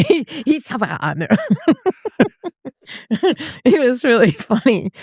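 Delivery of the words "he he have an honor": laughing mimicry of Spanish-accented German; imitated Spanish-accented German. Friend recalling two funny classmates, mock Spanish-accented German. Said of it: "the way they pronounced their German".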